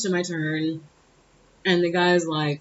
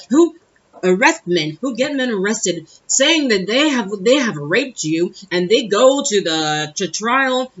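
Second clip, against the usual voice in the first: raised, emphatic lecturing; incensed man; 4 semitones higher, 5 dB louder, slower than usual. Retold or imitated. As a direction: build into a slow, loud, indignant accusation. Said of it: "he's getting incensed".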